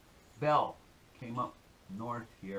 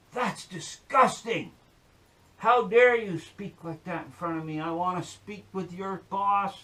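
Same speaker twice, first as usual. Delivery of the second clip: high, loud indignant complaint; hammed-up scandalised protest; acted outrage; 6 semitones higher, 8 dB louder than usual. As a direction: play an affronted lady demanding the boss. Joke's lady is outraged at zookeeper.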